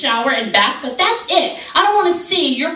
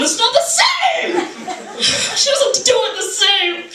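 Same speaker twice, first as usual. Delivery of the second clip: high-pitched, hammed-up indignation; mock outraged viewer; 5 semitones higher than usual. Viewer at home lamenting the news, mock outrage. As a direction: wail in mock despair at the TV. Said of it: "are any of you sitting at home going".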